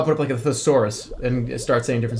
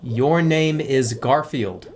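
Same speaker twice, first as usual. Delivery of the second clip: slow teaching voice; slower than usual. Shown as a demonstration. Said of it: "teaching the fish its name".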